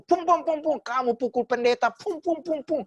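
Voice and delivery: high, punchy imitated blows; punch sound effects. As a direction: mimic fists landing.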